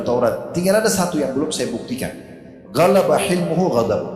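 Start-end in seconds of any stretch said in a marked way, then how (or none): 2.7-4.0 louder, chanted Arabic phrase; recited Arabic. Real, not acted. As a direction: intone it like scripture.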